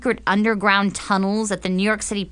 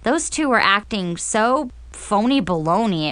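plain reading voice

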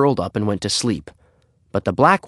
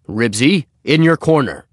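a higher, louder stern father commanding; father's stern order; 5 semitones higher, 5 dB louder than usual. A narrator's reading. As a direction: order the dog to its corner like a stern dad. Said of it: "ordered Mister Huggins".

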